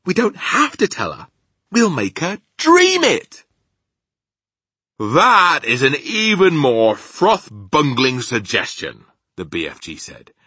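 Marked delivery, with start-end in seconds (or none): 0.0-3.5 high, loud, slowing for the reveal; excited. Acted. Sophie revealing the dream plan, excited. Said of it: "Sophie said excitedly". 5.0-9.0 loud, high, slow rustic giant; scornful giant. Acted. Giant dismissing Sophie's dream plan, scornful.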